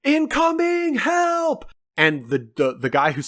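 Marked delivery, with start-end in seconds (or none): 0.0-1.6 high-pitched mock cry for help; hammed-up screaming, voice way up high; imitated scream. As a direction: call out in a drawn-out falsetto alarm. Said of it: "they're screaming, like".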